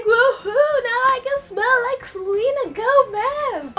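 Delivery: high, loud fangirl squeal with a laughing cry; giddy shriek of joy; excited squeal. Retold or imitated. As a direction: squeal it like a thrilled teen fan. Excited fan squealing about perfume.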